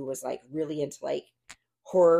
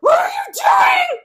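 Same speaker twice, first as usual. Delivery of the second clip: much higher, louder mock shout, drawn out; inner panic yell; 14 dB louder, slower than usual. Inside her head as her friend introduces her, a panicked yell. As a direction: scream it silently at your friend. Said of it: "in my head, I was like".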